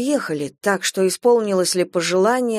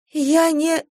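high, meek, cut short; helpless high-pitched interjection; timid protest; 8 semitones higher than usual. A narrator's reading. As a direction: try weakly to break in, high. Wife cut off mid-protest.

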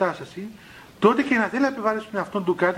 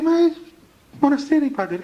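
high-pitched acted protest of the obvious; lightly acted, high incredulous monk; mock incredulous reply; 7 semitones higher than usual. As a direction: answer as if it were self-evident, pitched up. Acts monk answering the puzzled tester.

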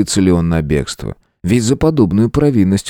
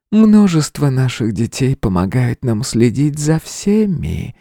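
slow seductive hiss, higher; breathy intimate voice; 5 semitones higher, slower than usual. A narrator's reading. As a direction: purr it slowly like a spider siren. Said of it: "тем же интимным придыханием". (интимное придыхание)

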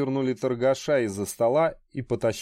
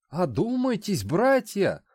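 higher, startled appeal; taken aback; 6 semitones higher than usual. Acted. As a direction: caught off guard, pleading. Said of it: "опешил от подобных слов Одноперст".

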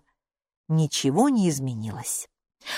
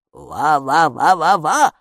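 slow, louder woof syllables; dog barking; 6 dB louder, slower than usual. A narrator's reading. The dog Eku barking in the bushes. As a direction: bark like a small dog.

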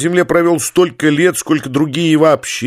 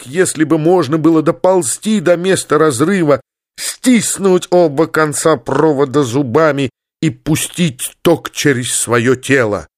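ordinary delivery, nothing marked

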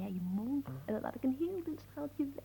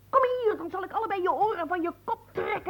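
raised, furious threat; higher and louder, boiling with rage; angry threat; 6 semitones higher, 8 dB louder, faster than usual. Acted. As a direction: shout him down like a wronged fiancée. Furious girlfriend threatens him.

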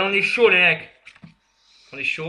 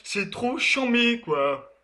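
french street slang; 5 semitones higher, much slower than usual. Retold or imitated. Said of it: "ceux qui font que parler en verlan".

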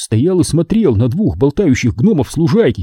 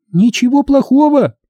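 very high indignant squeal; high-pitched outraged question; 13 semitones higher, 4 dB louder than usual. A narrator's reading. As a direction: squeal it in disbelief. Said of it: "взвизгнула Минди".

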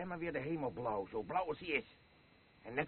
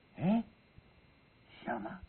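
usual speaking voice

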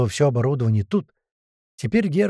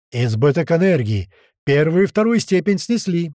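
raised voice, urgent report; alarmed report; 5 semitones higher, 4 dB louder than usual. A narrator's reading. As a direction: call it out over the commotion. Technicians report failure amid alarm. (тревожный громкий доклад)

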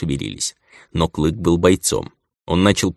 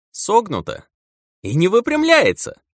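much higher, giddy and astonished; gleeful amazement. Acted. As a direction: squeal it with delighted wonder. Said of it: "С весёлым изумлением отозвался". (с весёлым изумлением)

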